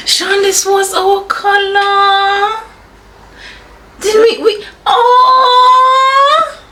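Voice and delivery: high, tearful, half-laughing cries of joy; moved almost to tears by the surprise; choked-up happy crying; teary and overwhelmed. Real, not acted. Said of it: "you're gonna make me cry".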